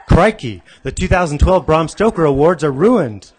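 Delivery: hammed-up Australian dismay; Australian accent. [name] on the floor, mock Australian accent. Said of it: "in an Australian accent".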